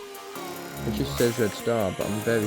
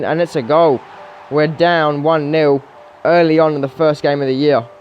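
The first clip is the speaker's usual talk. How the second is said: higher-pitched football commentary; live play-by-play; 5 semitones higher, 5 dB louder than usual. Real, not acted. Gamer calling his team's early concessions, live play-by-play. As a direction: call the match live as it unfolds.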